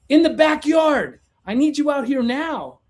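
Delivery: high, loud urgent call; drawn-out mock-panicked holler; frantic client. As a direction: imitate a frantic customer calling someone outside. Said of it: "she opens the door all frantic".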